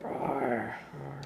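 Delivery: soft breathy tiger roar; whispered roar. Shown as a demonstration. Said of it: "The tiger say?".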